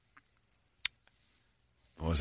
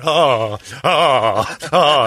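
imitated moans, building louder; mock orgasmic moans; 5 semitones higher, 4 dB louder, much slower than usual. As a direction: moan helplessly, each one bigger. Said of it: "sitting in the hospital bed going".

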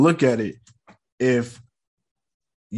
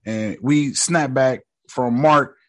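ordinary talk, nothing marked

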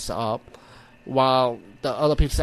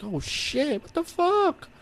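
scared reaction; 14 semitones higher, much slower than usual. Retold or imitated. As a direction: squeak it out in comic panic. Acts out being scared by voice.

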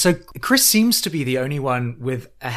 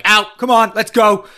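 higher, louder bark; mock bossy order; 8 semitones higher, 8 dB louder than usual. Mimicking Albie storming out, higher and loud. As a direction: order them out. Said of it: "he's like".

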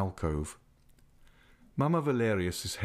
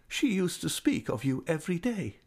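put-on high, tender old lady's voice; fond old lady; 8 semitones higher than usual. A narrator's reading. Old lady invites Raoul near, voice high and fond. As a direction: coax him close like a loving old aunt.

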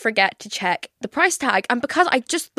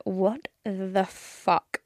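slow, hushed, stunned what the fuck; stunned disbelief; 7 dB quieter, much slower than usual. Retold or imitated. Seeing the price on the card machine, hushed stunned disbelief. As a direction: mouth it in quiet shock at the bill.

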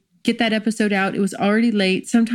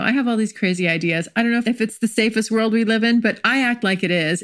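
ordinary talk, nothing marked

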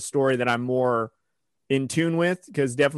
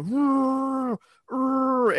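high imitated bear growl, pitched way up; 12 semitones higher than usual. Retelling a podcast story, a high mock bear growl. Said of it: "all you hear is the bear go".

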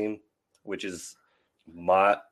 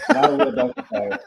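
laughing through the words, much higher and louder; 5 dB louder than usual. Real, not acted. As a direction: repeat it while cracking up. Echoing a funny remark, laughing.

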